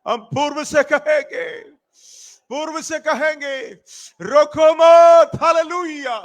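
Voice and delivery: full-force preacher's shout, words stretched long; fervent prayer command. Real, not acted. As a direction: command it in prayer, holding the last word.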